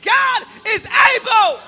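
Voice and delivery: like you mean it. Shown as a demonstration. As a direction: drive every word home, slow. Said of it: "Come on, say it like you mean it".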